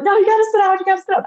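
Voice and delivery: high, scratchy insistence; too worked up to talk properly; scratchy, excited urgency. Retold or imitated. Wife insisting her husband sit, scratchy with excitement. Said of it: "my voice was all scratchy".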